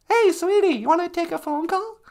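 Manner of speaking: high sugary wife, calling to her husband; sweet wife voice. Acted. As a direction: lift the voice high as the cheery wife. Higher wife voice.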